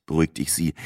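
ordinary delivery, nothing marked

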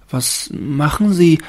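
hohe, langsame, staunende Baumstimme; Stimme des Baumes. A narrator's reading. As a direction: als ängstlicher Tannenbaum fragen. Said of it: "dachte der Baum". (hohe, zaghafte Baumstimme)

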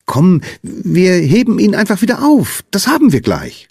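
put-on high, eager child's voice; encouraging little girl. A narrator's reading. Lina offering to help the Sandman, high encouraging voice. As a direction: pitch it up and rally your friend.

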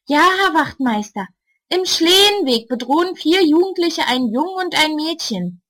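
composed woman reporting to the police; calm older neighbour. A narrator's reading. Ulla calls the policeman about the gang, firm and composed. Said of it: "Ruhig spricht sie in ihr Telefon".

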